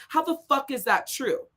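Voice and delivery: ordinary talk, nothing marked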